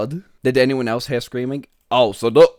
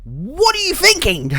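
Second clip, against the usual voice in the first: high, loud what are you thinking; incredulous at her choice; shocked high-pitched scold; exasperated squeak; 5 dB louder than usual. Real, not acted. Exasperated at character.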